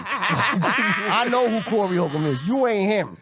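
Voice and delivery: high, slow mock rebuff; dismissive bouncer. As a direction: repeat the bouncer's put-down with drawn-out emphasis. Repeats bouncer's rejection.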